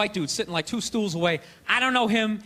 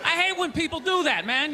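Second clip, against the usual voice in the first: usual speaking voice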